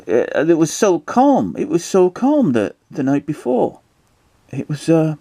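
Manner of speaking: high, put-on British character voice; helpful roadside man. Man recalling the night before, high put-on voice.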